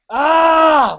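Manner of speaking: high, loud cry of frustration; frustrated yell. Retold or imitated. Rider cursing the endless hills, yelling. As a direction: let out a sharp yell. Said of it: "Just like".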